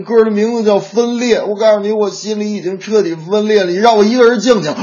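high-pitched, hammed-up sobbing sister; mock crying. Sister telling brother to leave her alone, mock crying. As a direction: play a heartbroken fangirl falling apart. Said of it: "哭的那叫一个惨呐".

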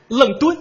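愣敦 said as leng-dun instead of Lun-dun; fake American London. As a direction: mispronounce 愣敦 with a flat leng. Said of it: "我们发的是标准的美式英语". (故意把伦敦念成愣敦)

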